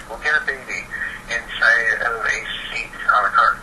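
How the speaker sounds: ordinary talk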